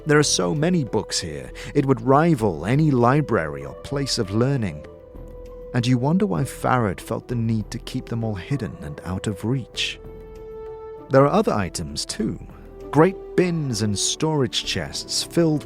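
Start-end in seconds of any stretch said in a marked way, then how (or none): none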